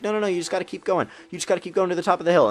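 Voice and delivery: fast, high mock mom voice; mom impression. Mom insisting son keeps driving, fast high mock voice. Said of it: "and she's like".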